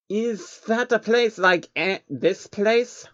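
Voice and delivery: high, slow creature voice; innocent changeling. Acted. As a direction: naive little alien asking.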